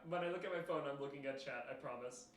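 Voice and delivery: normal talking voice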